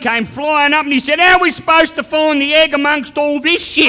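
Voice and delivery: loud, high, yelled gag; telling the sperm joke loudly; shouted punchline. Retold or imitated. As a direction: belt out the punchline.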